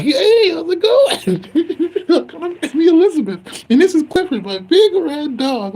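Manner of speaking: squeaky sing-song plush toy greeting you; stuffed animal mascot. Retold or imitated. Mocking the weed brand's plushie logo, high cutesy cartoon voice. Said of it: "They got this stuffed animal".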